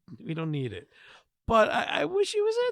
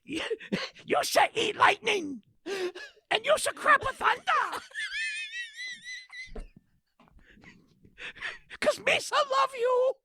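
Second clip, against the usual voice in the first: mock foreign voice. Co-host mimics the voicemail caller in a hammed-up voice. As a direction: ham up a garbled broken-English caller. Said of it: "mock voicemail impression".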